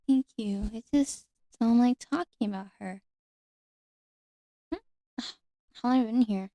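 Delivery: soft, hesitant fairy in ASMR roleplay; gentle, halting fairy confiding softly; soft-spoken nature fairy. Acted. Fairy tells a visitor of her past, soft-spoken.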